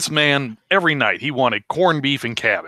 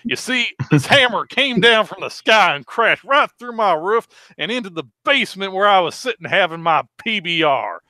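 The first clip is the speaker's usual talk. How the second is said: high, drawling yokel telling his tale; 7 semitones higher than usual. Acted. Acted old redneck monologue.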